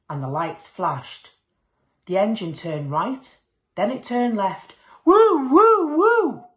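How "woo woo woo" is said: very high, loud woo woo of a horn; horn imitation. A narrator's reading. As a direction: belt it like a fire engine horn. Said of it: "went the horn".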